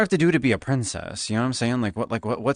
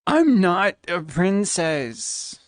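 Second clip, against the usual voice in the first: slow, high, stretched denial; high, slow, exaggerated whine; drawn-out protest; 8 semitones higher, much slower than usual. Real, not acted. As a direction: drag it out in mock protest.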